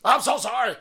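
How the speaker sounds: high, loud overdone "sorry"; exaggerated apology. Real, not acted. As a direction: ham up the apology.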